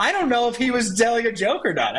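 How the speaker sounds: high, loud, laughing disbelief; amused disbelief. Retold or imitated. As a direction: say it still half laughing. Retelling his own laughing reaction.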